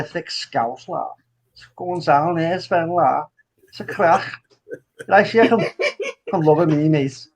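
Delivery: put-on broad Scouse; thick Scouse accent. Offering the mates another accent, thick Scouse. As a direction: lay on a thick Liverpool accent. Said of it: "thick scouts".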